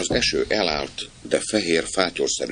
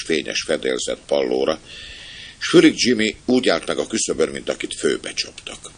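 ordinary delivery

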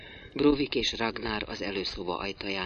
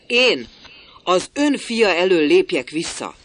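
high, loud indignant cry; outraged; 8 semitones higher, 9 dB louder than usual. A narrator's reading. Builder indignant at stepping aside for Brovik's son. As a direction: hurl the question back in disbelief.

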